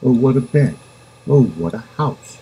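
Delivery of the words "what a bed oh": ordinary delivery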